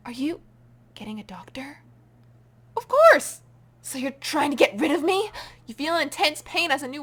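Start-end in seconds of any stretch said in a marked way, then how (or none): 0.1-1.8 fearful whisper. Acted. Asking secretly about help. 2.8-3.4 indignant. Acted. Retort to the creature.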